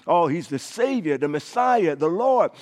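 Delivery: usual speaking voice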